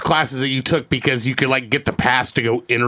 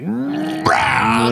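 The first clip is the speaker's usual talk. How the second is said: drawn-out high growling "rah"; mock roar; 11 semitones higher than usual. Friend showing what tequila does to him, mock roar. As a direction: roar like the liquor just kicked in. Mimics tequila hitting the blood.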